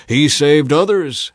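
loud, slow sneering scoff. A narrator's reading. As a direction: spit out the words with contempt. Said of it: "they scoffed".

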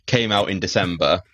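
ordinary talk

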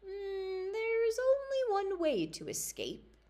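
high, eerie enchanter's daughter; sly high voice of a young witch. Acted. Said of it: "she said".

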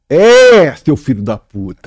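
loud, high swear of delight; joyful shouted curse. Real, not acted. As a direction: roar it out in happy praise. Loud praise of a beloved beer.

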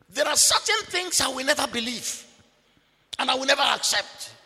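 ordinary talk

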